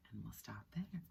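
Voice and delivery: soft, unvoiced whisper to wrap up; whispered sign-off. Real, not acted. Reader closes chapter softly.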